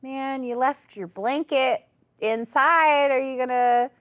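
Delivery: high, slow, overly polite hint; mock-friendly high hint with a little laugh; fake casual hint. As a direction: sweetly nudge him to leave. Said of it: "trying to drop some casual hints".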